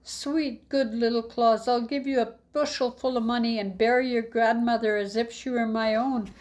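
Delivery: a hint of a frantic innkeeper; pleading innkeeper. Innkeeper pleading, voiced by narrator.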